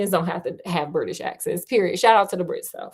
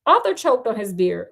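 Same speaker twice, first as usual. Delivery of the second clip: ordinary delivery, nothing marked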